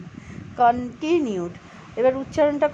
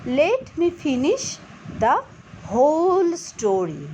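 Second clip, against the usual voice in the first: slow model reading; 2 semitones higher, much slower than usual. Shown as a demonstration. Teacher models the line slowly for students. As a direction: Let me finish the whole story, very slow and careful. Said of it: "উচ্চারণটা জোর করো".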